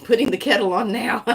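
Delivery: laughing through the question, quick; amused, quick, giggly delivery. Real, not acted. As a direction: break into a laugh while asking. Laughs at the tea card.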